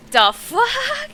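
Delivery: high, loud burst of disbelief; mock outrage. Acted disbelief at missing viewer.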